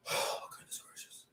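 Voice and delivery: breathy whisper, almost no voice; whispered exclamation. Retold or imitated. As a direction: whisper it as a mock gasp of dismay. Echoes his retold exclamation.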